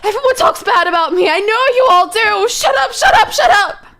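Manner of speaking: hysterical high-pitched outburst; cracked, frantic yelling near tears. Acted. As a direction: scream back at everyone mocking you. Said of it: "What are you yelling about?".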